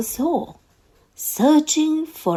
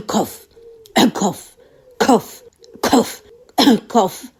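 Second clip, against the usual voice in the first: loud acted coughs; coughing sounds; 4 dB louder than usual. A narrator's reading. Narrator to children, loudly acting out coughs. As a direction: bark out the coughs one by one.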